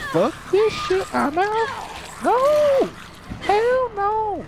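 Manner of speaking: squeaking way up high in panic; high panicked squeal. Real, not acted. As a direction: flee in a high shriek. Panicked retreat from enemy.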